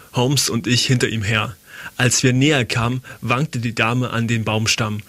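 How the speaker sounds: ordinary delivery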